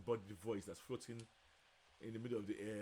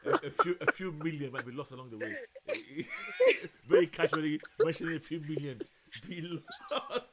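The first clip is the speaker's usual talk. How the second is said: laughing; 5 semitones higher, 11 dB louder than usual. Real, not acted. Laughing at own joke.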